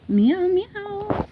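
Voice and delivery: high squeaky meowing at the cat. Pretend. To her cat Ember, a high playful meow. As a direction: meow like a kitten to call her.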